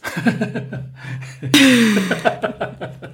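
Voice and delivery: a long, high burst of real laughter; hearty laugh. Real, not acted. Guest cracking up at his own wine-turns-to-vinegar joke, hearty laugh.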